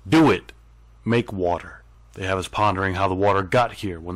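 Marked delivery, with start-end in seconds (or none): 0.1-0.5 sharp loud higher dare. Acted. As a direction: spit out the challenge. Taunting gods to make water.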